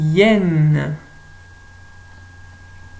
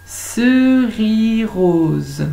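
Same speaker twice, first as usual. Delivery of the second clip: cerise rose drawn out, louder and higher; very slow word; 4 semitones higher, 5 dB louder, much slower than usual. Shown as a demonstration. Teacher to pupils, very slow loud cerise rose. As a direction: stretch cerise rose slowly for the class. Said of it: "ce qui est en trop".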